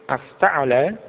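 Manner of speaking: "Afta'ala" dipping, then sweeping up on the last syllable; questioning intonation. Shown as a demonstration. Teacher shows the Arabic question tone, rising intonation. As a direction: lift the end of "Afta'ala" into a question. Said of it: "dengan nada semacam itu".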